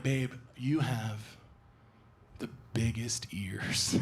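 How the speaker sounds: slow, breathy teasing whisper. Retold or imitated. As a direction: tease him in a hush. Girlfriend whispering tease.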